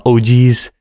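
mock Minnesota oh geez; a light Fargo oh geez; Midwest oh geez. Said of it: "just a lot of".

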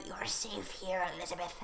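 soft, hushed reassurance; calm the patient gently; soothing nun. Retold or imitated. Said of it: "And they're like".

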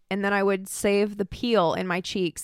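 normal talking voice

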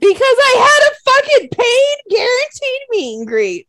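high, very loud, drawn-out wail of grievance; outraged yell. Real, not acted. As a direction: shout the complaint at the top of your range, dragging each word. Venting over a lost meet-and-greet.

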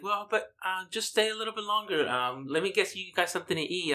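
high, soft coaxing imitation; imitated aunt's gentle plea, high-voiced; pleading aunt. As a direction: coax guests to stay, pitched well up. Aunt pleads with them to stay.

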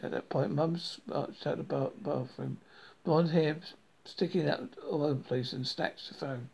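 ordinary delivery

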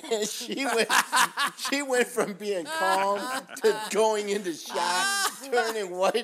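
high, drawn-out telling through laughter; laughing loudly. Real, not acted. Medic telling co-hosts how the patient panicked, laughing loudly. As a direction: push the punchline out at full volume while cracking up. Cracking up over story's punchline.

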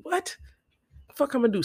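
squeaked high what, then incredulous; can't believe the idea; mock disbelief. Teller mocking the hookah-DJ plan, high disbelief.

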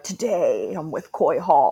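mock deep host intro. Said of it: "To make your voice, like, super deep".

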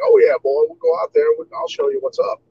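lightly imitated Southern drawl, cocky challenge; a Kentucky foreman's drawl, half-hammed; mock Southern drawl. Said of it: "in his southern drawl".

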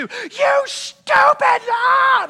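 high, loud, slow drawn-out road-rage yell; hammed-up fit of rage; furious scream. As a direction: scream it at the driver who cut you off. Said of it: "I'm screaming".

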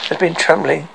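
ordinary delivery

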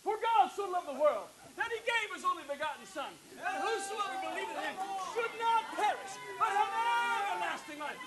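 high, projected open-air preaching; outdoor crusade voice. Shown as a demonstration. Preacher demonstrating outdoor preaching, high crusade voice. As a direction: proclaim the verse high to a distant crowd. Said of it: "crusade voice".

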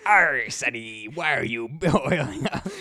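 slow growly pirate; pirate crab voice. Pretend. Mr Krabs pirate voice.

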